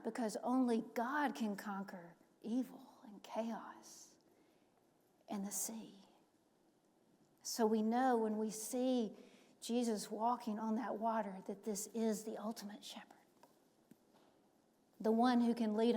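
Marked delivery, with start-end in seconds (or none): 0.0-6.0 hushed, breathy whisper. Acted. Preacher whispering to the congregation about God, whisper. Preacher hushing on God's power. 9.6-13.0 hushed, breathy whisper. Acted. Preacher whispering to the congregation about God, whisper.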